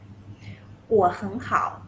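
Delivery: "我很好" said very slowly; slow model. Shown as a demonstration. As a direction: drag out "我很好" for learners. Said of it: "how to answer this question".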